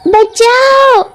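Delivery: high, shrill-pitched scream for help; terrified scream. Acted. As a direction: scream in terror at the witch. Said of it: "नैना जोर से चिल्लाती है".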